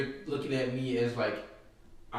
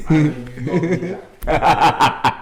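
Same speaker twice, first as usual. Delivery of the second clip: loud put-on New York voice, hammed up; louder mock Brooklyn swagger; fake New York accent; 3 semitones higher, 13 dB louder, slower than usual. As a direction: boast it like a born New Yorker. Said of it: "you can say".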